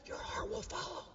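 hushed whispered revelation. Real, not acted. Preacher finishing Jesus' saying for congregation, confiding whisper. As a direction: whisper it like a secret.

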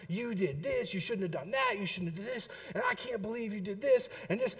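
softly rattled-off list of reproaches; half-voiced nagging tirade; mock scolding. Wife telling off son in the car, mock scolding. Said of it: "she was laying the business down".

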